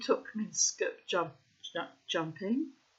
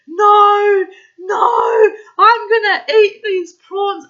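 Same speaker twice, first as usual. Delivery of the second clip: insistent shout; 12 semitones higher, 16 dB louder, much slower than usual. Retold or imitated. Brian insisting on prawns.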